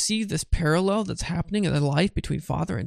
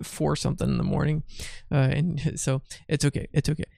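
ordinary delivery

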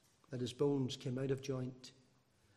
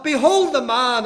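Behold the man flung out high and loud; shouted behold; 10 semitones higher, 17 dB louder than usual. Retold or imitated. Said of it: "he was saying something like this".

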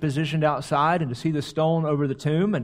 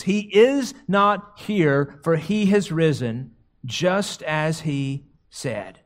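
slow solemn reading; much slower than usual. Retold or imitated. Reading the angel's message slowly.

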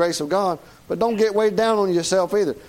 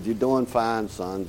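lower, softer, fatherly reassurance; gentle reassurance; 6 semitones lower, 4 dB quieter, slower than usual. Retold or imitated. Preacher voicing God gently reassuring a struggling son. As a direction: soothe him like a father.

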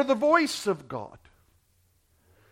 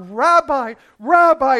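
rising, louder joyful cry; 4 semitones higher, 5 dB louder than usual. Acted. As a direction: call out in growing recognition. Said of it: "she cries out".

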